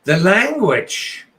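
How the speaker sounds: loud, high-pitched protest at bad language; exasperated, loud reprimand; upset scolding. Real, not acted. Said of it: "getting all upset with me".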